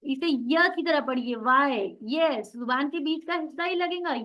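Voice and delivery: ordinary talk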